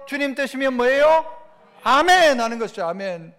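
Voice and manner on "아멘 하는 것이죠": ordinary talk, nothing marked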